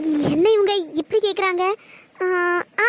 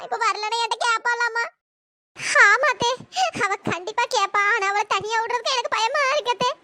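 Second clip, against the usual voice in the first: ordinary talk, nothing marked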